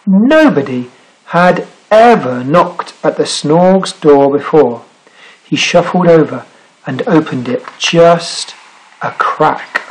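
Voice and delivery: plain reading voice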